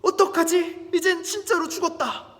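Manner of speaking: high, loud dread; startled, despairing outburst; shocked panic. Acted. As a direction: act a villain realising he is doomed. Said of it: "하만은 깜짝 놀랍니다".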